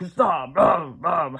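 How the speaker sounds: loud barked-out words; forceful dog-voice command, imitated; dog telling off. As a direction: bark the word like a gruff dog. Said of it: "like she's telling me".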